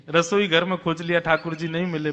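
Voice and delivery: plain reading voice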